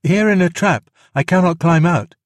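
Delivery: high, loud call from a trap; crying out. A narrator's reading. Trapped boy calls to his rescuers, crying out high. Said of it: "cried Mowgli".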